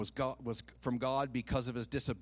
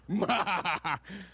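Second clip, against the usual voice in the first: hammed-up villain cackle, louder and higher; mock evil laugh; 4 semitones higher, 4 dB louder than usual. Preacher mimicking his vengeful wife, mock evil laugh. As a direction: cackle like a cartoon villain plotting revenge.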